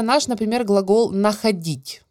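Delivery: normal talking voice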